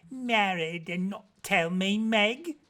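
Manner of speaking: mock-scold Meg fondly; good woman crying out. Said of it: "cried the good woman".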